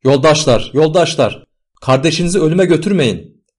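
high, loud appeal; desperate calling out. A narrator's reading. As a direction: plead with those hauling a brother away. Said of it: "atlara seslendiler".

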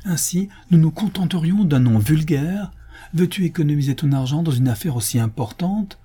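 high-pitched wifely reproach; indignant exclamation. A narrator's reading. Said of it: "s'écria Rachel". (reproche indigné, voix haute)

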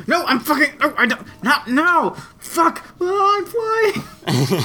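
high, laughing mock panic as the wings lift off. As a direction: squeal in pretend alarm, half laughing. Wings pop out under stress.

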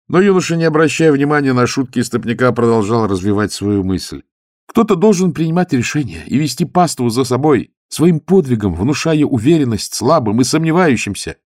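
high, loud zealous youth; eager young monk. Acted. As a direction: lift the voice, earnest and keen. Youth's speech, raised.

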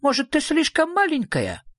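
high, indignant small-animal voice; small creature's high protest; indignant little creature. A narrator's reading. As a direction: demand it indignantly, pitched right up. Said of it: "возмущённо спросил зверок Шнырок".

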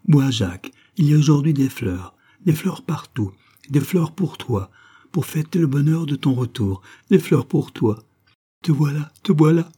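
high, delighted exclamations; joyful, childlike. A narrator's reading. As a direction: gush happily like a child again. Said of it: "joyeuse, comme redevenue enfant".

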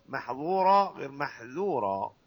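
محظورة with heavy ظ, then محذورة with light ذ; slow minimal pair. Shown as a demonstration. Teacher shows students محظورة then محذورة, slowly. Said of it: "هناك فرق".

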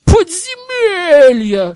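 high, loud, slow hissed outrage; furious hiss. Acted. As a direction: spit the word out in fury. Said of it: "шипел он".

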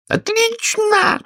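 high, drawn-out yell of approval. A narrator's reading. Queen yells approval to her soldiers, high and slow. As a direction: stretch it out in a very high squeal. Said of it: "завопила королева".

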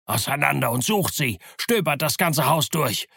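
insistent, rising shouted order; repeated command. A narrator's reading. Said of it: "wiederholte Pugh und schlug mit seinem Stock".

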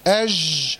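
أج ending in a continuous zh, no closure; lax running jeem. Shown as a demonstration. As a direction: let sound run through the ج of أج like French j. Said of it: "ما ينفعش".

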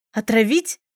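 sharp rising outraged question; indignant disbelief. A narrator's reading. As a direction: flare up at the accusation. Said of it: "взвилась Марта". (возмущённо взвилась)

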